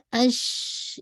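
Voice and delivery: اش with air rushing out; slow sound demo; ush sound. Shown as a demonstration. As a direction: say اش slowly as a spread sh. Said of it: "اس کی sound اش جیسے ہوتا ہے".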